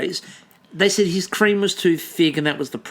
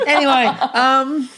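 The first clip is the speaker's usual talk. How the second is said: laughing; 8 semitones higher, slower than usual. Real, not acted.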